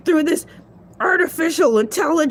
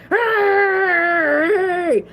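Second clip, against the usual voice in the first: excited shout; 3 semitones higher than usual. Acted. Shark host hyping up his viewers with a shout. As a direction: hype shout with a crying edge. Hype shout after the jingle.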